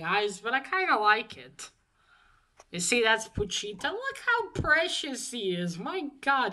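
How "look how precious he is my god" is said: high-pitched adoring gush. Real, not acted. Showing viewers a drawing of precious Pochita, gushing. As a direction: gush over something adorable.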